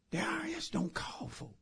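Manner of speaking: high, very soft near-whisper; hushed disbelief. Acted. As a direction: say it under your breath, incredulous. Pastor's hushed reply to the caller.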